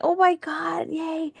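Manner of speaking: high-pitched imitation of a thrilled mother; excited mom. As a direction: squeal it lightly, like a delighted mom. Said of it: "she was excited for me too".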